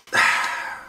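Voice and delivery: long unvoiced sigh of defeat; a sigh of giving up; weary sigh. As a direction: let out a tired, defeated sigh. Character sighs in story.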